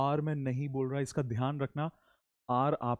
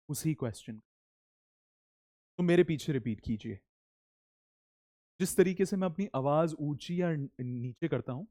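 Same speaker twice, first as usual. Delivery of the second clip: ordinary talk, nothing marked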